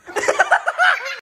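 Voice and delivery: high delighted laugh; gleeful squeal of laughter; high burst of laughter. Real, not acted. Laughing at catching her.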